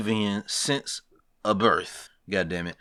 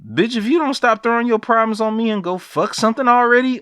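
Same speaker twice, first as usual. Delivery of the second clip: much higher, louder fed-up rant; half-joking imagined yell at a pushy crewmate; exasperated outburst; 10 semitones higher, 4 dB louder than usual. Retold or imitated. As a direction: blow up at her in frustration. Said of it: "And it's like".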